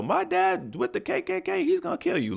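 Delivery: very high, thin-volume imitation of a kid yelling; mock child's scream. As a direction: mimic a screaming child in falsetto, without real volume. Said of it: "He screamed out the window".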